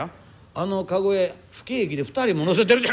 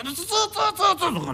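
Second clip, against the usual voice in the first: imitated shuffle; 9 semitones higher, much slower than usual. Imitating a sumo wrestler's shuffle.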